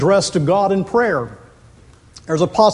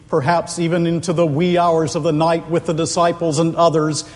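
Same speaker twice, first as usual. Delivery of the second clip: ordinary talk, nothing marked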